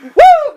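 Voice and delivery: high ringing woo shout; mock Ric Flair woo. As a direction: let out the Nature Boy woo. Ric Flair entrance call.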